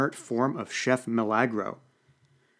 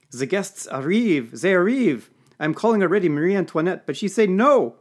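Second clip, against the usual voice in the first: put-on frantic, louder and higher French-accented cries; a French butler shouting in garbled English; panicked French accent; 8 semitones higher, 7 dB louder than usual. A narrator's reading. Serge panics to the narrator about arriving guests, loud French accent. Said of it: "Serge threw his hands up".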